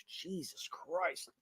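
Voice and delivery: soft, slow, under the breath; stunned half-whisper, drawn out; awed mutter. Real, not acted. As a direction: let it drop almost to nothing. Stunned at the death toll.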